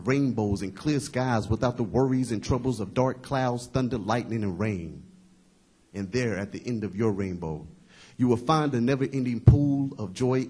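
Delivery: forceful, rolling recital that eases down at the end; impassioned spoken-word poem. Acted. Poet promises the heartbroken the Lord's help, impassioned recital. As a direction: drive through the lists, then let it settle. Poem resumes after a slip.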